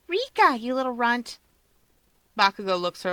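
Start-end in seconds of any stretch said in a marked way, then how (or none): 0.1-1.4 put-on high bright girl voice; higher pitched female character; young woman voice. A narrator's reading. Rika's voice, high.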